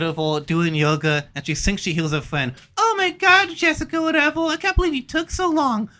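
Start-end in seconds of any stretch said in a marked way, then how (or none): 2.8-5.9 put-on high, bubbly valley-girl voice; mock cheerful girl. Yoga woman greeting her friend, high and bubbly.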